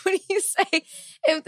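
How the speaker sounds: helpless laughter breaking up the words; real laughing. Real, not acted. Co-host tries to explain the Boondocks thought, laughing hard. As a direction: squeeze high words out between laughs. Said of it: "I'm laughing".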